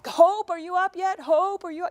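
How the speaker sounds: high, loud mom call up the stairs; yelling up the stairs. Retold or imitated. Mom yelling up the stairs to wake her daughter. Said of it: "I yell at her from the stairs".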